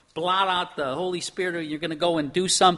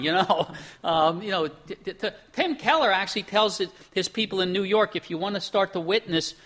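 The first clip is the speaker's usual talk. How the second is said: ordinary talk, nothing marked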